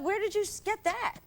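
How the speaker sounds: very high, indignant retold question. Actress confronting her director over a quote, indignant.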